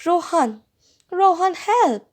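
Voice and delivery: high, urgent cry for help; frightened plea. A narrator's reading. Mother drawing away from the window, frightened plea. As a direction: plead for help, pitched high. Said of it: "she whispered".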